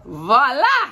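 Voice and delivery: loud, bright grand reveal; showy reveal. Acted. Josephine unveiling the outfit for Daisy, a loud showy voila. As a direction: present it like a magic trick.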